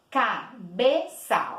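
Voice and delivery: "Cabeça" slowly split, CA, BE, ÇA; slow, a pause after each syllable of "Cabeça". Shown as a demonstration. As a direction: say "Cabeça" in three separated beats. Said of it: "Três vezes".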